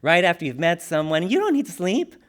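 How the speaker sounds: normal talking voice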